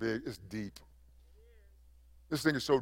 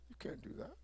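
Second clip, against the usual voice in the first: breathy whisper, almost no voice; preacher whispering a warning to believers; 5 semitones higher, 21 dB quieter, faster than usual. Acted. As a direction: hush it like a secret warning. Repeated warning, dropped to hush.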